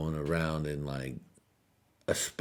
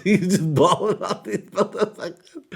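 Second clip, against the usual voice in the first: much higher and louder, laughing through it; laughing squeal; 13 semitones higher, 10 dB louder than usual. Shown as a demonstration. Repeating the line to the audience, a high loud laughing squeal. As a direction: squeal it out while cracking up. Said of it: "repeated in a squeal".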